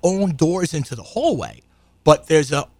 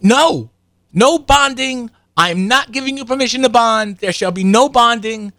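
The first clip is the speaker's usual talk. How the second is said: higher, louder mock of a furious mother; angry refusal; 8 semitones higher, 4 dB louder, slower than usual. Karen refusing the dentist permission to bond, mock outraged. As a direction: imitate her flatly forbidding it. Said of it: "she's like".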